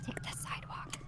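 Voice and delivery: hushed whisper. Acted. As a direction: whisper it under your breath. Telling someone the route quietly.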